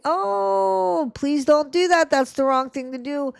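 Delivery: high, prissy mock parent. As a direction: chide in a high fake-sweet parent voice. Parents voiced scolding gently.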